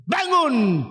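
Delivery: high, drawn-out order to rise; commanding call. Retold or imitated. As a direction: call the dead youth up with authority. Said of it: "aku perintahkan engkau". (seruan tinggi penuh wibawa)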